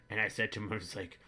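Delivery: ordinary delivery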